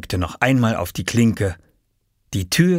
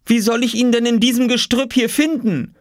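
high, raised complaining question; exasperated call; 4 dB louder than usual. A narrator's reading. Mother among the bushes looking for the key, calling exasperated. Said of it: "rief Mama von unten".

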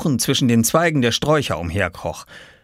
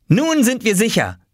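put-on high, triumphant boyish call; excited call; 13 semitones higher, 4 dB louder than usual. A narrator's reading. Oskar hopping through the locked room, calling excitedly. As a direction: call it out in high-pitched relief. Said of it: "rief er und hopste durch das Zimmer".

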